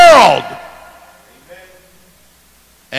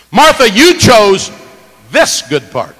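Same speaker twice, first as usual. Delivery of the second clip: rebuking Martha; 7 semitones higher, 11 dB louder, slower than usual. Pretend. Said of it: "whether the emphasis should be on that".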